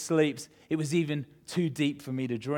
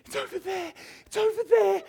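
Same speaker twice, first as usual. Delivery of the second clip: very high, urgent squeal; panicked wife imitation. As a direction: imitate a frantic wife pointing out danger. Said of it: "because she went".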